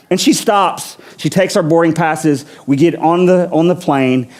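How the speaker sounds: usual speaking voice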